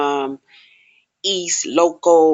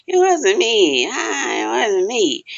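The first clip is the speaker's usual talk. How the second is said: denying; 5 semitones higher, slower than usual. Retold or imitated. Accused woman denying.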